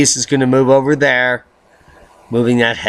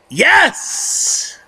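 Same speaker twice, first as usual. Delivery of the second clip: much higher squeal of joy; cheering yes; 13 semitones higher than usual. Acted. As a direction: cheer like your team just scored. Cheering the cat made it.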